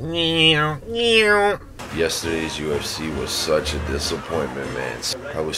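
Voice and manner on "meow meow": high playful mock meows; cat meow. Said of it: "I was like".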